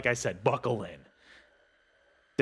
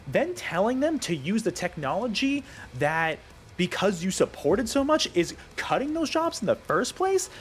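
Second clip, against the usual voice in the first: worked-up rant; 7 semitones higher than usual. Real, not acted. Venting at Microsoft over layoffs, worked-up rant. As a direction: vent at the layoffs, voice climbing. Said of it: "I know I'm getting worked up".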